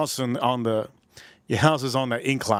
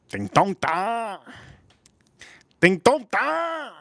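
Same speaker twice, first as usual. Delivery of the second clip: higher, drawn-out mock chant; mock viewer's exclamation; 7 semitones higher, much slower than usual. Imagining viewers reacting to the movie, drawn-out mimicry. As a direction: stretch each syllable and lift the pitch. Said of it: "You're gonna say".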